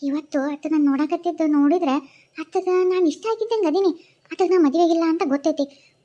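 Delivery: ordinary talk, nothing marked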